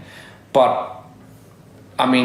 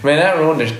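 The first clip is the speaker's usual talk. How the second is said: usual speaking voice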